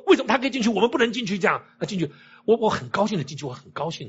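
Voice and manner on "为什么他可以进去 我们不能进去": high-pitched mock clamour of protest; 吵嚷抗议. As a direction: imitate an indignant crowd, pitch jumping up. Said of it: "哇，外面又吵了". (模仿人群高声吵嚷抗议)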